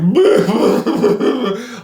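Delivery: loud high choked sob burst; sobbing cry. Retold or imitated. Father remembering his son's birth, a sudden sob. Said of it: "I'm just crying uncontrollably".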